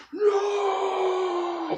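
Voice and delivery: falling scream. Retold or imitated. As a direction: wail as you fall to your death. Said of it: "you see, like".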